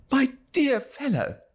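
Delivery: put-on high, overjoyed old friend; excited Traddles. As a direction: gush with delight at a reunion. Traddles again.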